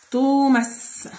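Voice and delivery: "توماس" sounded out with a lengthened first vowel; drawn-out vowels. Shown as a demonstration. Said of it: "فيها مد بالواو ومد بالألف".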